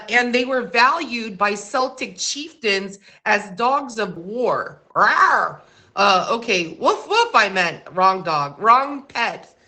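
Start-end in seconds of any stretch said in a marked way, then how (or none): 4.9-5.6 mock dog-of-war roar; short playful "rawr". Host illustrating dogs of war, playful roar. 6.8-7.3 high-pitched pretend woofs; little high dog barks; playful bark. Host fixing the wrong animal noise, high playful bark.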